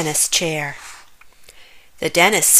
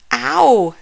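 pained moan; 5 semitones higher, 4 dB louder than usual. A narrator's reading. Zack waits at the dentist with toothache, pained moan. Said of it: "moaned Zack".

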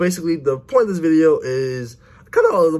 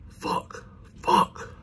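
whispered curse; 7 dB quieter than usual. Real, not acted. Watching team lose lead.

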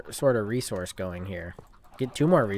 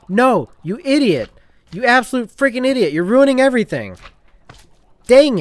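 high, loud outraged yelling at the game; furious shout; 11 semitones higher, 9 dB louder than usual. Real, not acted. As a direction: scream at the idiot mobs who wrecked everything. Chickens ruined his crops.

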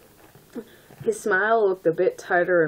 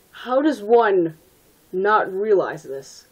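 slow, emphatic disbelief; drawn-out, louder incredulity; exasperated; 2 semitones higher, 4 dB louder, slower than usual. Real, not acted. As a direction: spell it out in exasperation. Exasperated repeat at the text.

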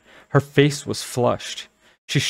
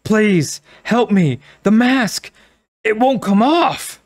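high, loud panicked plea; shrill cry, rising panic; hysterical; 11 semitones higher, 5 dB louder, slower than usual. Acted. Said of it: "pleaded in a shrill, frightened voice".

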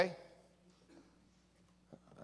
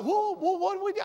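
high mock-startled protest; surprised staff; 14 semitones higher than usual. Fired staff member protesting to pastor, high mock surprise. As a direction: play the shocked fired worker. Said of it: "they're like".

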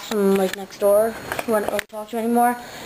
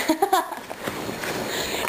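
soundless shaking laugh; breathless laugh at the bird; silent laugh; 8 semitones higher, 7 dB quieter than usual. Real, not acted. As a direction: laugh with no voice at all. Bird just hit the window.

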